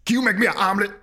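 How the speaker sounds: Mister T impression. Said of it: "yours is more of that, uh, this Wonderful World guy".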